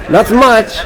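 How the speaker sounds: high-pitched imitation of a scared reply; mimicked fear. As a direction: mimic a frightened guy brushing it off. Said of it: "he was terrified".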